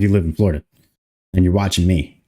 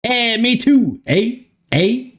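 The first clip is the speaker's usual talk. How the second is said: put-on much higher, playful Canadian accent; mock Canadian eh; 12 semitones higher, slower than usual. Streamer joking with Canadian chat, high mock Canadian eh. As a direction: squeak out eh like a cartoon Canadian.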